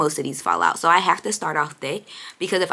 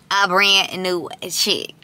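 slow, loud, proud reveal; drawn-out punchline; 3 semitones higher, 6 dB louder, much slower than usual. Real, not acted. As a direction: stretch out the brag. Punchline to own question.

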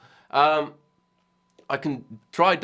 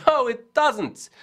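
high, drawn-out emphatic denial; imagined answer, pitched way up and slow; Johan Segerborn; 12 semitones higher, 4 dB louder, slower than usual. Retold or imitated. Imagining the video maker's verdict, high emphatic denial. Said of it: "he would say".

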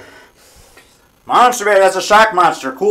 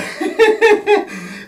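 high laugh. Real, not acted. Laughing at own story.

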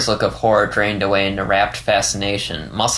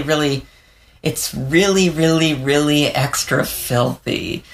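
higher, stammering fox voice; hesitant confession in a pitched-up character voice; Leo, nervous and eager; 5 semitones higher than usual. Acted. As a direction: stumble over the words, slowing for emphasis. Leo nervously proposes an idea.

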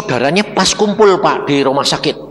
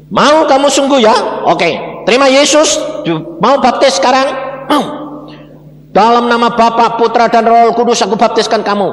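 baptism pronouncement; 5 semitones higher, 4 dB louder than usual. Retold or imitated. Preacher baptizing the man.

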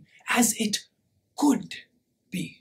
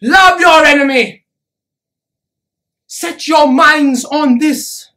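loud, relentless exhortation; full-voiced sermon at fever pitch; impassioned preaching; 3 semitones higher, 8 dB louder than usual. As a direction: thunder the commands at the crowd. Preaching a hard command to crowd.

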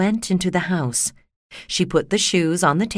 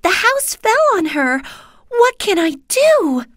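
high, louder, worried cry; alarmed Dorothy; 14 semitones higher, 5 dB louder than usual. Acted. Dorothy dismayed the house killed someone, high and alarmed. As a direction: panic over what she has done.

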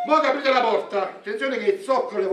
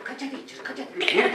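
put-on deaf old woman; 5 semitones higher, 4 dB quieter than usual.

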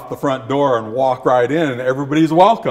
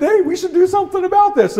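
high, quick dog voice; high cartoon dog rallying the others; roused dog; faster than usual. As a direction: pipe up keenly as one of the pack. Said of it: "they all started thinking".

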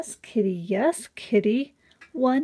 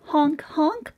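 mock goose honk; 6 semitones higher than usual. Reading animal sounds to children.

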